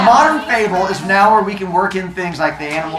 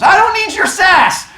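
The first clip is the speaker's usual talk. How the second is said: sassy rabbit; 6 dB louder than usual. Acted. Rabbit snaps at turtle.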